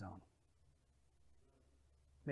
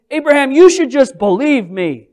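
impatient rebuke; 10 semitones higher, 22 dB louder than usual. Retold or imitated. Instead of saying.

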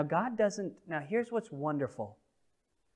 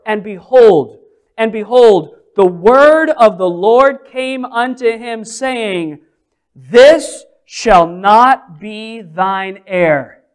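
loud, slow, weighty King James reading; solemn scripture reading; 6 semitones higher, 21 dB louder, slower than usual. Acted. Preacher reading God's words to the church, slow and loud. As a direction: read the verse like thunder.

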